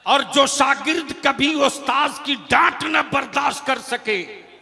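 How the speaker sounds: measured start rising to full-force oratory; preaching, building. Real, not acted. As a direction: build the parallel lines into a rousing sermon. (जोश में बढ़ती तक़रीर)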